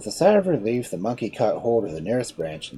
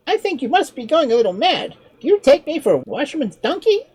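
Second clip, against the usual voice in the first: higher, lighter voice for the sly monkey; clever monkey; 8 dB louder than usual. Acted. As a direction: play the monkey, a touch mocking. Monkey teases.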